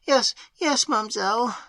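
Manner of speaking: high, tearful maid's reply; sobbing. A narrator's reading. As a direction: answer through tears. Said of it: "sobbed Annette".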